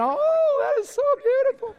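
high squealing show-off voice; gushing fiancée. Retold or imitated. Fiancée showing off her ring at party, high squeal. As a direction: squeal over the new ring. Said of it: "she spends the whole time walking around".

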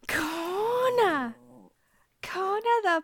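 high excited dog greeting. Real, not acted. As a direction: squeal the dog's name, delighted.

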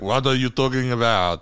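a hammed-up, clumsy French accent; bad French accent. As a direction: put on a fake French accent, and do it badly. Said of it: "That's a terrible French accent".

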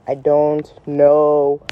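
very slow, word by word; exasperated, drawn out. Pretend. Telling the lip-mouther they are lost, slow exasperation. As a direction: spell out the exasperation slowly, stretching each word.

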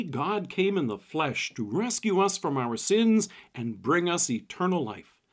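normal talking voice

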